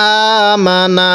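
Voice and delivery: আমানা slow, with the long a held on the hamza; recited demo. Shown as a demonstration. Teacher demonstrating the reading, slow recitation. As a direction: draw out আমানা slowly. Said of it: "কিভাবে পড়ব?".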